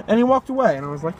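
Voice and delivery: ordinary talk, nothing marked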